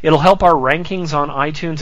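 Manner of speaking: normal talking voice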